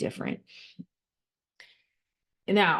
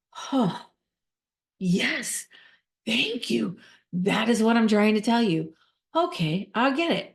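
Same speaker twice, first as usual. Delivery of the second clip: higher relieved voice; relieved person feeling heard; 3 semitones higher than usual. Retold or imitated. Relieved client thanking the listener, higher relieved voice. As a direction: sigh of relief, finally understood. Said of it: "it's like".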